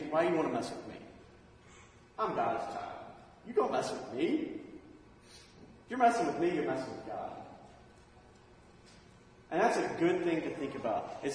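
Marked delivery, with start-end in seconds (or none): 0.1-7.4 defiant attitude. Retold or imitated. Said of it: "your attitude should be".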